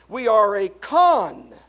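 "convict" split, CON loud and drawn out, then "vict"; stress on CON. Shown as a demonstration. Preacher revealing what sinners are, convict split and stressed. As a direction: punch CON in "convict", pause before the end. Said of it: "Now watch this".

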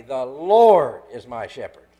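slow phrase swelling on "Lord"; stress on LORD. Shown as a demonstration. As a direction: LORD loud and drawn out.

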